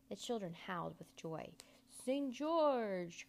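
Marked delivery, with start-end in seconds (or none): 1.9-3.3 loud, higher stage call; crying out. A narrator's reading. Magician summons the next puppet on stage, crying out. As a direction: call out the hero's name, drawn out. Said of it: "cried the magician".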